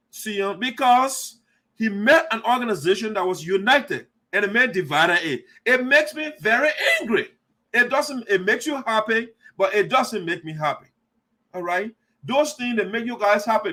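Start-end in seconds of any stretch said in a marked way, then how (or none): 5.6-7.3 raised-pitch, indignant outburst; genuinely angry, emphatic; real anger. Real, not acted. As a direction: swing up hard in real anger.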